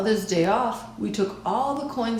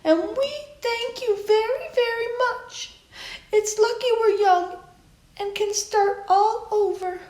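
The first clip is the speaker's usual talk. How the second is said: grandma's speech. A narrator's reading. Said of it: "my grandma made a speech".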